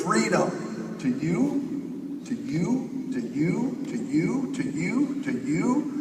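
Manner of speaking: stretched-out echo fading to nothing. Shown as a demonstration. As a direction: let it echo away like a voice in a canyon. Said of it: "I can't say six thousand U's with my throat".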